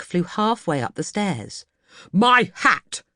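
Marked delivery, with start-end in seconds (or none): 2.1-3.0 louder, higher angry outburst through clenched teeth; between his teeth. Acted. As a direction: spit it out in fury. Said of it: "he said between his teeth".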